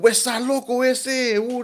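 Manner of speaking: high, drawn-out gang call; gang member's boast. Retold or imitated.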